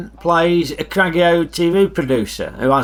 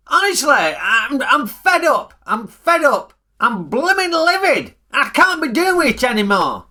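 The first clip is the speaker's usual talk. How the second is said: higher, fed-up ranting; mock rant; 6 semitones higher than usual. Ray ranting to viewers about costs, exasperated. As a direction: vent like a man at the end of his rope. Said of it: "I'm just ranting".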